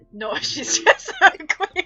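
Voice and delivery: high, loud, broken by laughter; laughing while reading. Acted. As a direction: blurt it out mid-giggle.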